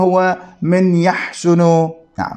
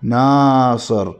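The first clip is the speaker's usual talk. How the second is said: slow demo; 5 semitones lower, much slower than usual. Shown as a demonstration. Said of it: "هذا الحرف متحرك مفتوح".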